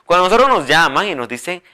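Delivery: usual speaking voice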